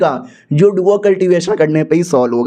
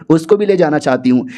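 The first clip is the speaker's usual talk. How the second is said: usual speaking voice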